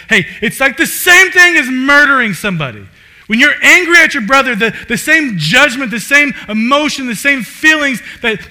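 loud, high preaching that builds in intensity; full-force preaching. Acted. Preacher warning the congregation about anger, loud and high. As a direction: pound the point home to the congregation.

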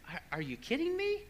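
very high, quick, stammered question; retold disbelief. Father hearing it was just an app, acted disbelief. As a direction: squeak out mock incredulity. His disbelief at daughter's explanation.

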